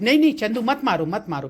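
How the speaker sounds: loud, fast cry of no, no; urgent call-out. Real, not acted. Calling out to Chandu to stop hitting the animals, loud and fast. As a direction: stop him quickly, raising the voice sharply.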